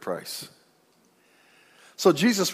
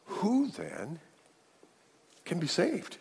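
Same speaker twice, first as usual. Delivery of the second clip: hushed astonished disciples; 4 semitones higher, 9 dB quieter, much slower than usual. Acted. Astonished disciples asking Jesus.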